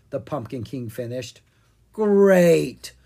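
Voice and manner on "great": loud, drawn-out groan of dismay. A narrator's reading. Owen learns of the creepy graveyard, groaning. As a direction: groan it out on a falling tune. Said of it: "Owen said with a groan".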